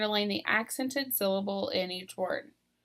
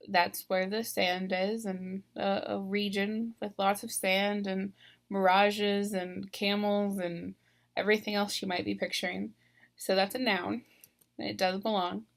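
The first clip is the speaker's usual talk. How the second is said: usual speaking voice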